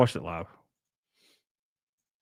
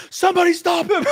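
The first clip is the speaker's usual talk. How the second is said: very high, loud squeal breaking into laughter; hammed-up falsetto scream; mock panic; 6 dB louder than usual. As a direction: shriek it in fake desperation. Mock alarm, repeated far higher.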